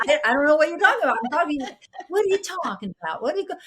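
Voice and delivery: high, fast Texas twang; Texas accent. Retold or imitated. Child protesting to stepmother, in a high put-on Texas accent. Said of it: "my English was so Texas".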